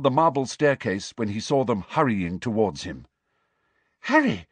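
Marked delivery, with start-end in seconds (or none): 4.0-4.5 high-pitched anxious call; frightened. A narrator's reading. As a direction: call his name in alarm. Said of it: "looking very frightened".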